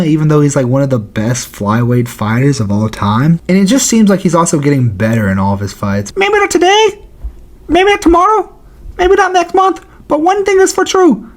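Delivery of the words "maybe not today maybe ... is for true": very high-pitched fighter impression; hammed-up squeaky vow to the fans; put-on voice, pitched way up; Brandon Moreno. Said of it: "Listen, Brandon Moreno".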